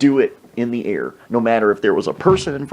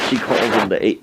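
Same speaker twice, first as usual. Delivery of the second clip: ordinary talk, nothing marked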